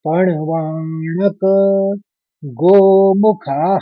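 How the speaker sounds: पणवाणक गोमुखाः said slowly and evenly; slow recitation. Shown as a demonstration. Teacher repeating the second quarter slowly. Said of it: "दूसरा चरण simple सा इतना सा है".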